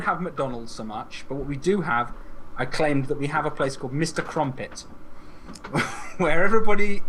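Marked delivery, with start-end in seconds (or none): 4.9-6.1 high silent wheeze of laughter; breathless laugh. Real, not acted. Podcast host cracking up at the crumpet joke, breathless high wheeze.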